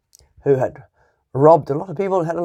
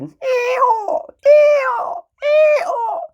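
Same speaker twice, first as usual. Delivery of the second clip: donkey bray; much slower than usual. A narrator's reading. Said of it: "And the donkey brayed".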